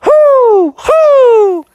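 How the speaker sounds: high, loud owl hoots; piercing drawn-out hooting; owl call. Acted. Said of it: "Bubby called out to it".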